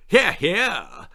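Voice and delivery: high, eager posh agreement; posh lady agreeing. A narrator's reading. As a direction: chime in like a snooty lady. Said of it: "agreed his lady wife".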